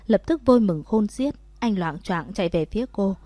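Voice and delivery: ordinary delivery, nothing marked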